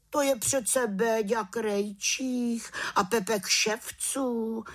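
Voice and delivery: surprised villagers. Acted. Surprised villagers recognise the boys.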